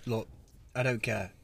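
ordinary talk

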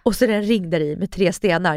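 ordinary delivery

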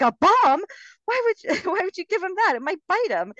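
high, loud mimicked disbelief; mock alarm. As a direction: imitate an appalled mother, rushing the protest in a high voice. Acted alarmed mother from a film.

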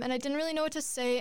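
ordinary delivery

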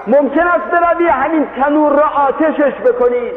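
a woman calls a man to light the oven, shouting. A narrator's reading. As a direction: call out to him from afar. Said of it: "زن فریاد کرد".

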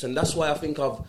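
ordinary delivery

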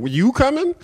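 high, startled "you coming?"; surprised disbelief. Retold or imitated. Caller shocked the officer is coming, voice jumping high. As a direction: ask it as if you can't believe it. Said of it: "And I'm like".

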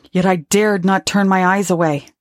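usual speaking voice

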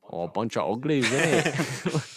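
mock Cockney, rising at the end; jokey British bloke accent. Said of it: "and I go".

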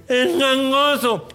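high, mock nasal voice; nasal-speaking man. The nasal man asks about the hump, mock nasal voice. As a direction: imitate a man talking through his nose. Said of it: "un gangoso".

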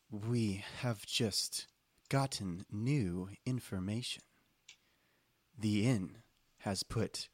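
slow, soft, deliberate delivery; measured librarian agent voice; sleepy office librarian. Acted. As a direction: deliver the briefing slowly and quietly, word by word. Game master voices Connie.

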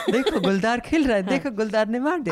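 high, fast, laughing mock documentary voice; Doordarshan documentary filler. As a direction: ham up an old state-TV nature filler, quickly. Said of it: "like a real Tat Doordarshan, like, filler".

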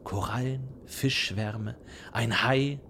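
normal talking voice

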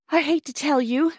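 put-on high, hurried girl's voice out of breath; breathless. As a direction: gasp it out after running. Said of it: "she said breathlessly".

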